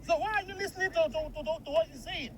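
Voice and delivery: angry. Acted. Husband challenging wife's trust in an online adviser, angry. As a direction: argue back crossly without shouting. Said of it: "Why are you so angry about it".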